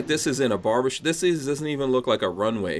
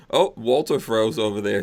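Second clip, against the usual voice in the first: usual speaking voice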